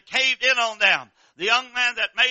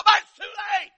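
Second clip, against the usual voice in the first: high, loud preaching shout; shouted climax; 12 semitones higher, 5 dB louder than usual. Real, not acted. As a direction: drive it home at full force.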